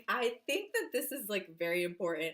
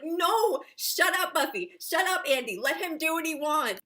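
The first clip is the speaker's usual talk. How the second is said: high, loud mock scolding of the girls; mock yelling at characters; 8 semitones higher, 6 dB louder than usual. Fan yelling at Buffy and Andy to let T J be. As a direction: shout them down so he gets his way. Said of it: "And I'm just like".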